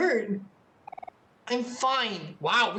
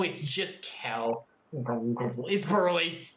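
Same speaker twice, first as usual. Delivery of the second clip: slow, low, drawn-out character read; put-on character voice; 8 semitones lower, much slower than usual. Gamer switches into a slow, low character voice. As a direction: slowly voice the rival kid. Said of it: "I need to do a voice".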